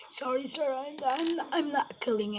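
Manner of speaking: high, hurried apology to a toy; pretend apology. Assembling the figure, high apology to the toy. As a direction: reassure the toy urgently.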